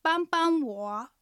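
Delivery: 帮帮我 said very slowly, each syllable clear, 帮 level then light, 我 dipping; slow Chinese demo. Shown as a demonstration. Teacher modelling 帮帮我 for Japanese listeners, slow and clear. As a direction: spell out 帮帮我 slowly for learners. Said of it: "おさらいしましょう".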